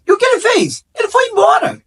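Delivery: mock shout of the friend's words; retold outburst. Man in the meditation group retelling his father leaving, high mock shout. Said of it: "um cara falava assim".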